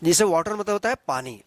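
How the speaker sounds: normal talking voice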